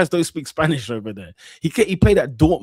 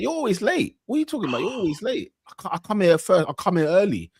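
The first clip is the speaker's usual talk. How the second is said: high-pitched mock Northwest London accent; Isak; 6 semitones higher, faster than usual. Host acting out a footballer's lateness argument, mock London accent. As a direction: imitate London street bickering, voice pitched up. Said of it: "He's talking like that".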